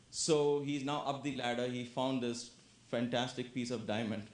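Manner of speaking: ordinary delivery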